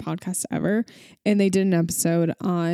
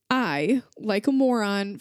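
ordinary delivery

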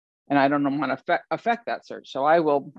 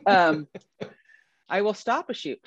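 ordinary delivery

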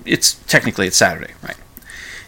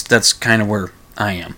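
ordinary talk

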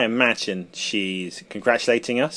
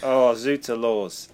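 mock French accent; 4 dB louder, slower than usual. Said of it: "speaking foreign languages in an accent".

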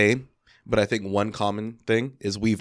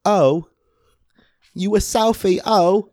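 very high, loud Londoner's question; mock London accent; 10 semitones higher, 6 dB louder, much slower than usual. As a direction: squeal it in a put-on London accent. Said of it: "just from that accent".

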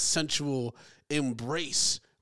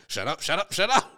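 mock angry father; 4 semitones higher, 5 dB louder than usual. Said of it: "He's like, he's like".

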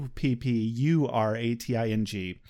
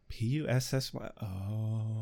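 dawning realization; 5 semitones lower, 11 dB quieter than usual. Retold or imitated. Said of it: "I was like".

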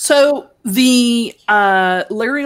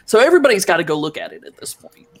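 ordinary talk, nothing marked

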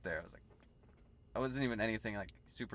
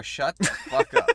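mock outrage; 9 semitones higher, 11 dB louder, slower than usual. Playful protest among friends.

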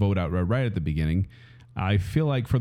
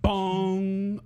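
high sung "bong"; ringing vocal bong; clock chime; 10 semitones higher than usual. Acted. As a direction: imitate a tolling clock. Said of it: "it's the clock".

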